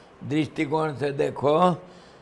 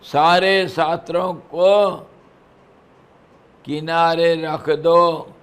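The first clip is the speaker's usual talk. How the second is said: louder, slowed command; emphatic preaching; 2 semitones higher, 6 dB louder than usual. Acted. Preacher urging listeners to set scriptures aside, emphatic. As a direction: lay it down firmly.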